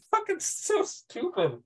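high, slow, laughing through it; squeezed out between laughs; real helpless laughter. Real, not acted. Laughing at the scene.

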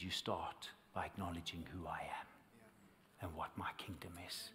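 hushed whisper for the voice of God. Retold or imitated. Recalling God's word to him, in a whisper. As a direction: breathe it, barely voiced. God's words retold to the preacher.

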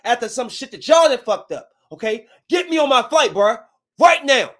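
loud retold outburst at the agent; angry, unchanged tone. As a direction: snap back loud, daring him. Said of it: "y'all thought I was gonna change my tone".

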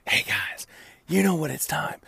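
hushed, husky mock radio-host voice; sexy radio broadcaster. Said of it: "I sound like a sexy radio broadcaster".

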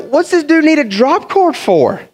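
high-pitched puzzled outburst; hammed-up baffled question; acted bafflement. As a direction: wonder aloud in mock disbelief. Acts out own baffled thought.

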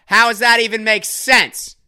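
high, drawn-out indignant comic wail; John Mulaney. Retold or imitated. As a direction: stretch each phrase high, then let it slide down. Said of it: "It's John Mulaney".

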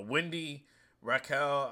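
usual speaking voice

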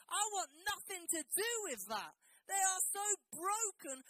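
high, loud whiny complaining rant; Jonah moaning. Retold or imitated. Jonah moaning to the sailors on the boat, loud indignant rant. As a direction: rant at the sailors like a sulky prophet. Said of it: "He moaned at them".

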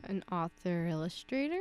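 "An author illustrator?" rising at the end; uncertain question. Real, not acted. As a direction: trail up on "illustrator" as if unsure. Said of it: "you said it with a question at the end".

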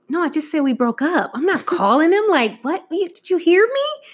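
high-pitched, incredulous protest; indignant disbelief. Retold or imitated. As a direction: push back at mom, rising to a squeal. Daughter baffled by mom's advice.

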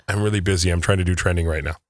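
low, soft, subdued mimicry; hushed mock "too busy" voice; imitating busy colleague. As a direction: drop the voice to mimic a preoccupied colleague. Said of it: "is when you're like".

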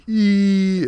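short high squeak; a tiny high-pitched "ee"; Patrick squeal. Retold or imitated. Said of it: "that's a thing Patrick does".

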